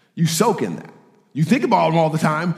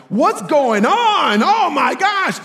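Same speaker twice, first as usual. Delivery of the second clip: high, slow, loud exasperated whine; impatient passenger; 13 semitones higher, 3 dB louder, much slower than usual. Retold or imitated. Passenger stuck on a plane complains: high exasperated whine. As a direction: whine it out like a fed-up stranger on a plane. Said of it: "the dude behind us is like".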